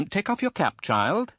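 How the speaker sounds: put-on high, imperious old lady voice; stern aunt. A narrator's reading. Stern aunt sizing up the widow, high and imperious. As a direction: look down on her from a height. Miss Betsy orders the mother.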